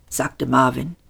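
plain reading voice

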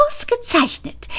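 "ausgezeichnet" jumps up very high, falling off; second "ausgezeichnet" squeaked out high with glee; squeaky high. A narrator's reading. Delighted character repeats "ausgezeichnet", squeaky high.

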